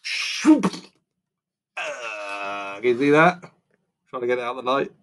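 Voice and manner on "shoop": high, quick "shoop" noise; whoosh. A narrator's reading. Reader imitating the brother's answer, sudden whoosh. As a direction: mimic a sudden throw with the mouth. Sound effect of the throw.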